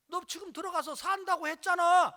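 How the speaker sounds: loud, high confrontational retort; angry accusation. Retold or imitated. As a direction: imitate a father challenging him. Father accuses him in story.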